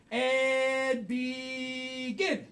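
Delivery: very slow, sung-out cue; drawn-out kickoff. Acted. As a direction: stretch it out like a game host. Host kicks off the round.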